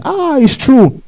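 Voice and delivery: sudden high yelp of dismay; a hint of panic, high and short; mock startled cry. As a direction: squeak it out like a caught sinner. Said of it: "The man shouted".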